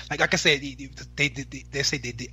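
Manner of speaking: tripping over repeated words; stuttering. Real, not acted. Co-host stammering as he restates his point in the debate, stuttering. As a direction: stammer and restart, stuck on the same word. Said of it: "ah, I'm stuttering".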